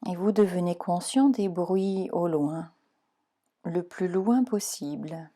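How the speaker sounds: calm, even meditation voice guiding relaxation; guided meditation. Acted. Leads Yoga Nidra relaxation.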